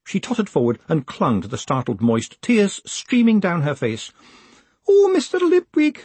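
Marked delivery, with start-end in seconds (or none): 4.9-6.0 high, loud wail. Acted. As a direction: wail his name in despair. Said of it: "she wailed".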